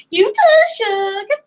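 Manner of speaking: very high, sugary Southern sign-off; sweet Southern accent. Retold or imitated. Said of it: "I used my sweet Southern accent".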